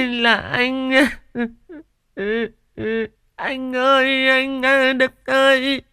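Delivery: hammed-up grief for show at the funeral; fake wailing. A narrator's reading. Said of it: "mụ lại mếu máo".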